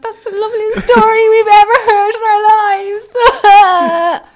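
high, loud wail of adoration; hammed-up, overcome with sweetness; mock tearful gushing. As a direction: wail it out as if moved to tears. Retelling their joint gushing reaction.

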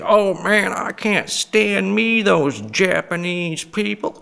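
high, drawn-out mock Southern accent. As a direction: drawl it slowly in an exaggerated Southern accent. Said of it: "go to a Southern accent".

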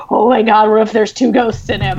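mildly awestruck, after a gasp; retold realisation. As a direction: re-enact a dawning idea with mock shock. Acts out sudden realisation after gasp.

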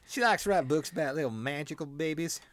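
put-on a light southern American accent; lightly drawn southern accent, a mocking author impression; J K Rowling southern accent. To the cohost, imitating the author in a lightly put-on southern accent. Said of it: "I hear her with a southern accent".

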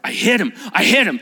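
acted panic. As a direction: blurt it out in alarm. Voices rich man's horror.

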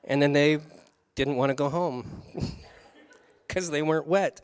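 laughing through the words. Real, not acted. Preacher retelling fish joke, laughing.

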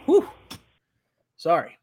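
catching breath after a swallow; just swallowed a drink; after a sip of beer. Real, not acted. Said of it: "Trying to talk and drink beer".

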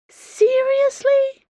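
a high, loud, drawn-out howl of disbelief. Acted. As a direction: howl it out, stretched and outraged. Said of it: "Dana howled at him".

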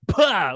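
loud imitated dad sneeze "Pah!". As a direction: bark out a mock sneeze. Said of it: "a dad sneeze is the".

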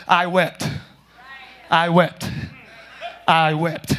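very low, monotone recitation; mock scripture voice. Preacher mocking God repeating one verse, low monotone. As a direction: intone it low, the same each time. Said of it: "he's not gonna say".

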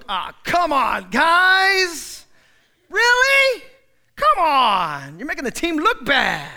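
loud, fed-up groan of a bystander; exasperated complaint. Acted. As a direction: call them out, annoyed, voice cracking up high. Preacher voices exasperated bystander.

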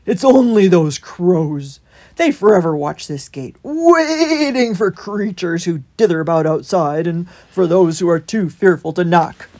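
loud, rolling character voice; hedgehog gatekeeper. A narrator's reading. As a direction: stretch key words in a big voice. Holgate explains the crows.

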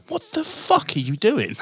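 high, slow incredulous question, half laughing; baffled co-host. Retold or imitated. Tom reacting to his demo partner's antics, mock incredulity. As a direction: imitate a mate's appalled disbelief. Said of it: "I remember Tom looking at me going".

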